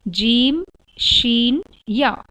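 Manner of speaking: "जीम", "शीन", "या" each said firmly from the middle of the tongue; letters demonstrated. Shown as a demonstration. Teacher sounding out the three letters for the class. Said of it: "अब ये देखेंगे कैसे एक जैसे हैं".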